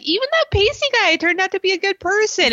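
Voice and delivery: high, prissy mock of AJ. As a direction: pitch way up to mock him. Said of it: "And he's like".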